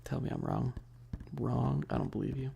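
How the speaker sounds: ordinary talk, nothing marked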